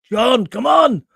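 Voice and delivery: loud, high mock exasperation; exasperated call-out. Host chiding listener John, mock exasperated shout. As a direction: shout it like a playful telling-off.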